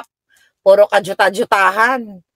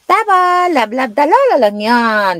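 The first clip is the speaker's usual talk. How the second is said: cooing to a grandchild; 5 semitones higher, slower than usual. Retold or imitated. Mother coos to grandchild.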